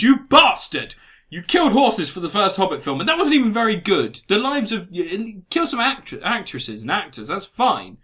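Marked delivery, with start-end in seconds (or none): 0.0-4.2 loud, high-pitched indignant rant; hammed-up scolding accusation; mock outrage. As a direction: explode at the director in comic fury. Mock rant at Peter Jackson.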